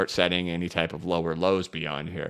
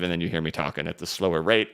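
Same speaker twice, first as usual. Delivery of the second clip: ordinary talk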